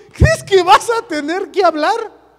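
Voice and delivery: high, loud, drawn-out incredulous question; exasperated retort. Acted. Preacher voicing God's retort to Moses, exasperated. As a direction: stretch it out in mock disbelief.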